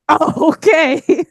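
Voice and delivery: drawn-out, puzzled "oh, okay"; slow baffled okay. Retold or imitated. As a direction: stretch it out, dumbfounded. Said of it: "And I was like".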